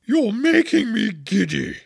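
high, loud, slow protest; irritated queen screaming; screamed complaint. A narrator's reading. Queen scolds the bowing gardeners, screaming. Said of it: "screamed the Queen".

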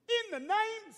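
mock-imitating dad casting out a demon; commanding rebuke. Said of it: "He said".

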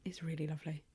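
hushed admiring whisper. Real, not acted. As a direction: breathe it softly over the book.

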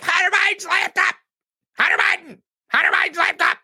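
squeaky high mocking whine of an online troll; high, nasal sarcastic chant of a troll. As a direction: parrot the trolls in a shrill cartoon squeal. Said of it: "They're like".

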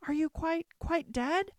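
high, pleading anguish; grieving girl over the still Beast; desperate cry. A narrator's reading. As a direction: beg him to answer through tears. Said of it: "she cried".

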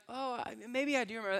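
high, quick, tentative mock voice; hesitant recollection. Acts women hesitantly recalling.

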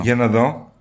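ordinary talk

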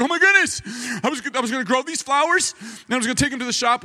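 high-pitched mock lament; distraught wife. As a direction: imitate a wife wailing over her loss. Retold wife laments lost flowers.

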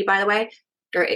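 ordinary talk, nothing marked

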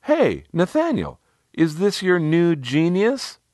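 high, slow teasing call across the fire. A narrator's reading. Woman teasing narrator's host across a fire pit, calling out high. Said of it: "spotted me and called out".

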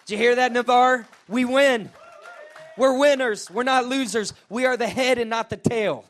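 rally the crowd with a big shout; excited shout. Real, not acted. Preacher building to a shout.

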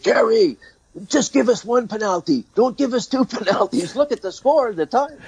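high-pitched pleading player imitation; Claude Lemieux. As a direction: plead with the referee in a comically high voice. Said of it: "Claude Lemieux came over. He said".

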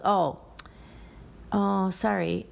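brushed-off "oh, sorry" with no real regret; careless, insincere barista apology. Retold or imitated. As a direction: toss off a fake sorry. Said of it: "it was insincere".

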